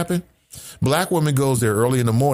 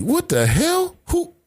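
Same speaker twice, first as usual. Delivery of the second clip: leaping high, what the hell in disbelief; exasperated; slower than usual. Real, not acted. Host exploding in disbelief at the author. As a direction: throw it up in disbelief.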